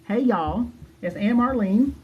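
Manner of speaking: high-pitched mock country accent; hammed-up country drawl in a lifted voice; Aunt Marlene. Host mocking a review-course teacher, exaggerated country accent. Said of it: "her country ass accent is just too much".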